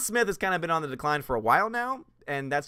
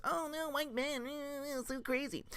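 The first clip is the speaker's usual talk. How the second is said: high breathy mock voice, softer; mocking imitation; 10 semitones higher, 10 dB quieter than usual. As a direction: mimic her fake outrage in a high soft voice.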